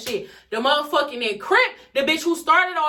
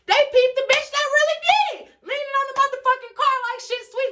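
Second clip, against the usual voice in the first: half-laughing disbelief, voice up high; outraged high pitch; 13 semitones higher, 4 dB louder than usual. Real, not acted. Telling the crowd how the real culprit lounged, high-pitched outrage. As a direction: pitch jumps way up, incredulous and louder.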